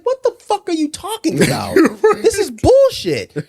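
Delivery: voicing Sid's outrage at the leaving partner; mock furious outburst. Reenacting Sydney yelling at Carmy, high and loud. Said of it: "She's like".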